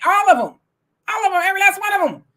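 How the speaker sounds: high, loud insistence; emphatic outburst. Real, not acted. Heated rant blaming all of them.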